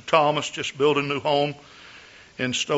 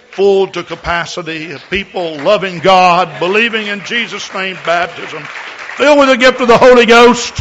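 preaching build; 5 semitones higher, 12 dB louder than usual. Real, not acted.

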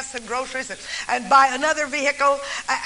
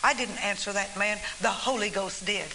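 tearful hush; 5 semitones lower, 6 dB quieter than usual. Retold or imitated. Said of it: "the old man began to cry again".

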